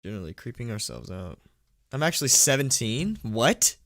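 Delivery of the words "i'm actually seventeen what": louder, higher astonished question; can't believe what chat said; real surprise at a viewer's age; surprised disbelief. Real, not acted. Reacting to a chat message.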